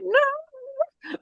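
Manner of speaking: high, dismayed cry of no; horrified no. Retold or imitated. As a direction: recoil in disbelief. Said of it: "it's like".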